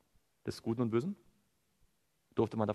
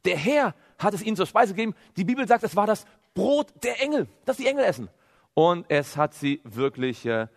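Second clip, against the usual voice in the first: ordinary talk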